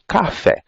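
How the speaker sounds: 'café' in two pulses, CA-FÉ, pitched high then falling; two syllable impulses. Shown as a demonstration. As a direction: demonstrate the two beats of 'café'. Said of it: "assim, dois impulsos".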